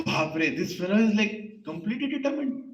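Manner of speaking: high-pitched amused astonishment; amazed. Acted. Sage marvelling at the determined small boy, amazed.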